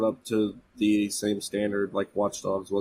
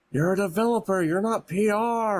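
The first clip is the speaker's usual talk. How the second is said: high-pitched exasperated scolding; higher voice, stretched final words; mock yelling; 10 semitones higher than usual. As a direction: yell it like a fed-up coworker, in jest. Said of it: "yelling at Sean".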